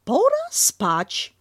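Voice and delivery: drawn-out bedtime call from Kanga. Acted.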